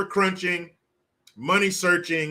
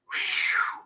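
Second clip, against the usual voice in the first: usual speaking voice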